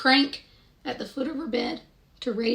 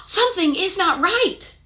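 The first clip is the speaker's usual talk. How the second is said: mock alarm; 9 semitones higher, 6 dB louder than usual. Miss Clavel senses trouble again.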